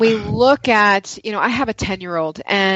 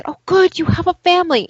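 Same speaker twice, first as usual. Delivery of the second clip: higher-pitched, pleased exclamation; lifted, delighted quote; happy relief; 9 semitones higher, faster than usual. Retold or imitated. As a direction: retell it as a glad, relieved reaction. Voices relief at dog's family.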